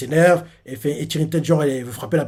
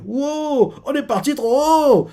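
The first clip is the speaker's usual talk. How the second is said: high, thrilled exclaim; excited surprise; 12 semitones higher, slower than usual. Retold or imitated. Luffy floating too high. (exclamation ravie et aiguë)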